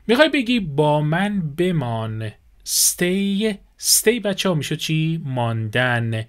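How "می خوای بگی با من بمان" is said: ordinary talk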